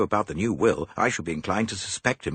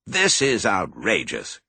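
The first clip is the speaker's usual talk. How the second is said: louder, slow outrage; furious cousin, louder; outraged cry; 5 dB louder, slower than usual. A narrator's reading. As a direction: spit it out indignantly. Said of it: "cried Graham".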